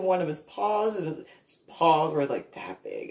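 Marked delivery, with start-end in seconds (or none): none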